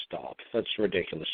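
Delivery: ordinary delivery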